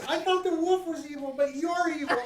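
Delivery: put-on a scared child begging to be left alone; crying little girl. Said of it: "she says".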